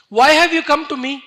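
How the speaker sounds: loud, high challenge; emphatic motherly remonstrance; forceful questioning. Acted. Mother's speech acted with sudden force.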